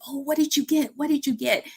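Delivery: high, bubbly imitation of a chatty passenger; lightly acted excited gossip; excited chatter. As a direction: mimic ladies gushing in the back seat. Ladies chatting excitedly in car.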